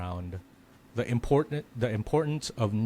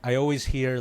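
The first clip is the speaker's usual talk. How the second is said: ordinary delivery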